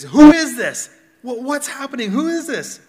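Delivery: loud, high-pitched astonished questioning; startled crowd. Acted. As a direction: burst out like a bewildered crowd. Acting out startled city crowd.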